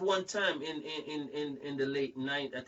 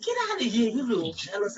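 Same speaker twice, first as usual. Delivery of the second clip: higher, loud dismissive start, then easing; retold indignant brush-off; 5 semitones higher, 4 dB louder, faster than usual. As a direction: wave him off in mock outrage. Retold angry brush-off of manager.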